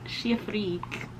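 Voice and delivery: slow, sly whisper; cheeky slow whisper; whispered joke. Pretend. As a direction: whisper the pun like a secret. Whispered pun on the name.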